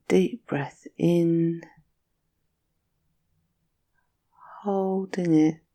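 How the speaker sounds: soothing Yoga Nidra guide; guided meditation. Acted.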